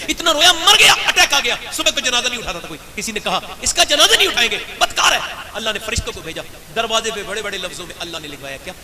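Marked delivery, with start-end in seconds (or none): 0.1-5.2 raised, high preaching cry; impassioned loud sermon. Real, not acted. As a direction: throw the story at the crowd full force. Preaching at full force.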